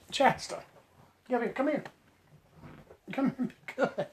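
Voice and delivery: hushed call to dog. Real, not acted. Quietly hushing the dog.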